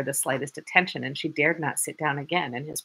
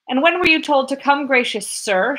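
high, loud, offended shout; 8 semitones higher, 6 dB louder than usual. Acted. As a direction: yell it up high, taking offense. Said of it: "shouted the lieutenant".

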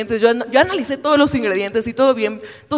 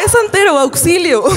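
mock panic; 6 semitones higher, 5 dB louder than usual. Mock panic after the announcement.

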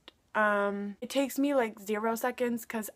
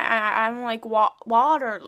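ordinary talk, nothing marked

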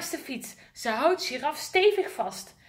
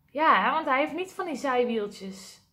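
ordinary delivery, nothing marked

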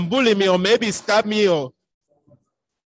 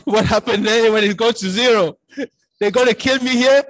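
laughing nervously at his own fear; nervous laughing thought; 5 semitones higher, 3 dB louder than usual. Retold or imitated. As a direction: chuckle through the inner panic, pitched up. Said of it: "in my head".

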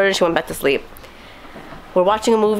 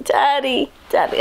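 put-on higher-pitched little kid voice; imitated child calling; 7 semitones higher, slower than usual. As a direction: call out like a sleepy little girl. Child calling for daddy, retold.

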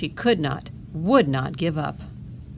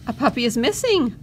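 Missus Bulldog announcing, a touch higher; announced; 6 semitones higher, 4 dB louder than usual. A narrator's reading. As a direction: call out the news to the pups. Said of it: "Missus Bulldog announced".

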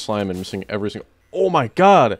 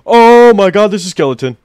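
high, loud panicked cry; loud frightened shout; startled yelp; 11 semitones higher, 14 dB louder than usual. Real, not acted. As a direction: jump out of your skin. Skeleton suddenly appears.

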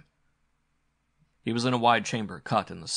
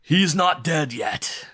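loud, slow, raised villain's taunt; gloating; 8 semitones higher, 10 dB louder, much slower than usual. Acted. Villain to his sobbing captive, gloating. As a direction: draw it out loudly, relishing it. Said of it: "laughed the Octoroon".

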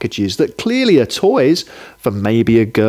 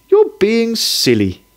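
higher, mildly weary wife voice; a gentle sighing scold, voiced higher; 10 semitones higher than usual. Wife to grumbling husband, a higher sighing scold. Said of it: "his wife said with a sigh".

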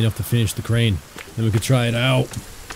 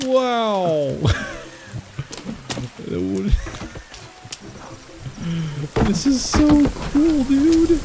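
excited; 11 semitones higher, much slower than usual. Real, not acted. Thrilled at his build working.

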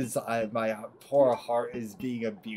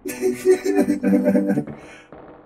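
loud high burst of laughing; long high cackle; real laughter; 7 dB louder than usual. Real, not acted. Laughing during quack game.

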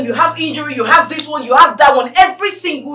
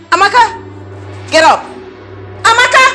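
raised, high-pitched demand; angry calling; 6 semitones higher than usual. Acted. Employer rousing her drunk maid, angry calling.

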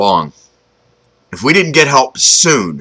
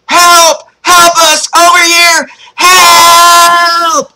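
very high, loud falsetto cry for help; falsetto scream; 5 dB louder, much slower than usual. Acted. Boy in the river screams for rescue, piercing falsetto. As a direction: scream it out in top falsetto, drawing out the last word. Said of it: "I raised my voice to its highest falsetto".

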